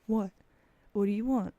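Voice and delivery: loud, demanding mock reply to a cat; calling to cat. Streamer retelling how they answered the calling cat, loud mock demand. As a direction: call out loudly as if answering a nagging pet. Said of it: "look at it and go".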